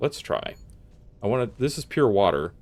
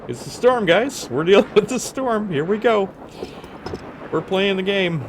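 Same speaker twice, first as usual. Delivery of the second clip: high, loud mock-excited call; hyped-up announcing; 11 semitones higher, 6 dB louder than usual. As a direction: call it out big, pitched way up. Mock announcing the game's storm.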